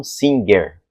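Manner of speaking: singer with a hard g; the Brazilian reading to avoid; wrong way. Shown as a demonstration. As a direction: singer said like the spelling, sin-ger. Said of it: "e não".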